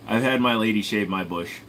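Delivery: ordinary talk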